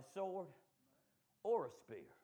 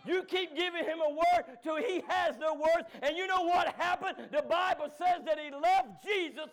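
higher, louder shouted preaching; full-force preaching; 10 semitones higher, 10 dB louder than usual. Acted. Preacher urging church to give him the Word, shouted.

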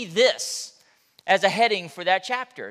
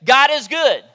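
loud, high preacher call; shouted call; 6 semitones higher, 10 dB louder than usual. Real, not acted. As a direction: shout it out to the church.

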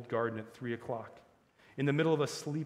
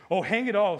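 higher, louder outburst; exasperated; 7 semitones higher, 9 dB louder than usual. A narrator's reading. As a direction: burst out in frustration. Scrub exasperated at witch's ignorance.

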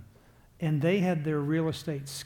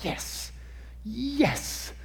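gleeful whispered yes; 6 semitones higher than usual. Retold or imitated. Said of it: "I'm going".

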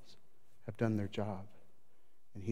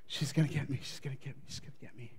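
fearful fading mutter; 8 dB quieter, faster than usual. Retold or imitated. Elijah fearing for his life.

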